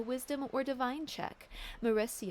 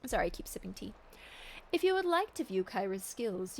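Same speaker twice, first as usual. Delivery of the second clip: usual speaking voice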